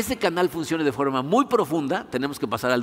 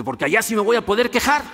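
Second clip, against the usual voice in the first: higher, lightly acted burst of frustration; exasperated outburst; 5 semitones higher, 4 dB louder than usual. Citizen finally answering the official, mock exasperated outburst. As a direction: let the punchline burst out higher.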